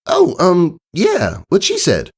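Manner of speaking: high hesitant filly voice; Sweetie Belle, nervous. Acted. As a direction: stammer it high and flustered.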